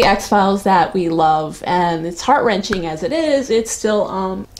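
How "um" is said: ordinary talk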